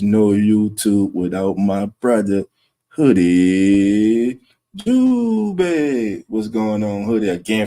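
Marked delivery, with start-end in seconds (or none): 2.9-6.2 drawn-out hype greeting. Real, not acted. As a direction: stretch both names out long, loud and high. Announcing a friend's arrival in chat.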